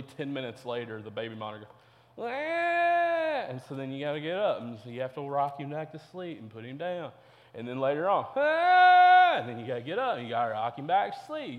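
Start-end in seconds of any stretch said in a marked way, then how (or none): 2.3-3.5 put-on high, piercing baby cry; mock baby scream on the monitor; baby wail. 8.4-9.4 put-on high, loud baby scream; imitating a baby screaming; loud baby wail. Baby crying again at night.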